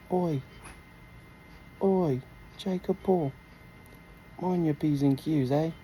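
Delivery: mock British pub warning; British accent. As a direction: call out like a British bloke. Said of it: "You like my British accent".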